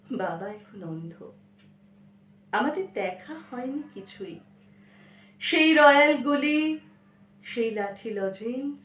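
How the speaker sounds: dramatic verse recital, swelling loud then hushed; theatrical, measured poetry reading with long pauses; poem recitation. Acted. Recites a poem after introducing it.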